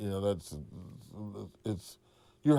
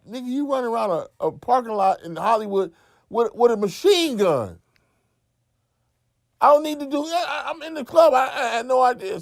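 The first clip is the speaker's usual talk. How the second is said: much higher and louder, incredulous; indignant retort; 13 semitones higher, 6 dB louder than usual. Retold or imitated. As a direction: throw the accusation back in his face.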